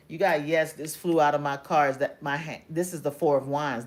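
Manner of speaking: usual speaking voice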